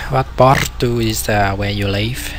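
usual speaking voice

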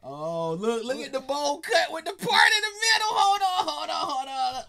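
loud, high-pitched exclamation of discovery; excited outburst. Real, not acted. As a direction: shout it out, thrilled to have spotted it. Excited discovery of photo on screen.

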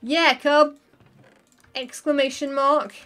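emphatic pretend yell at a friend; playful shouting. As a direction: call it out loudly, word by word. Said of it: "I'm shouting at him".

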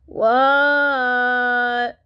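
loud, long-held answer; drawn-out shout across the yard; hollering back. Acted. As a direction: holler back, stretching the vowel.

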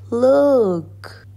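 high, drawn-out call; excited storybook shout. A narrator's reading. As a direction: call out to grab attention. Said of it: "shouted Walter Junior".

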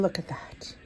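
hushed whisper over the dish. Real, not acted. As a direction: whisper it in awe. Admiring dessert, unvoiced.

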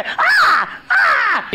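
high-pitched demonstration shriek; two very high mock screams; imitated scream. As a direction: imitate a timid maid's shrieks. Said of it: "રાડ પાડે".

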